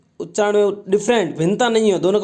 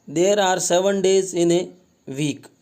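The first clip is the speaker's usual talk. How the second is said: slow reading aloud; much slower than usual. Shown as a demonstration. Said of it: "teacher reads sentence slowly".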